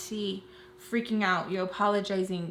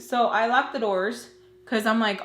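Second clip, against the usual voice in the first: ordinary talk, nothing marked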